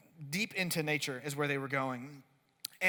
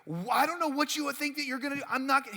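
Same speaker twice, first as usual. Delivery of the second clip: higher, louder, fast indignant outburst; ticked-off girlfriend; 8 semitones higher, 5 dB louder, faster than usual. Retold or imitated. Irritated girlfriend snapping back at boyfriend, higher and faster. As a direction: fire back in annoyed disbelief. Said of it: "makes her more ticked off".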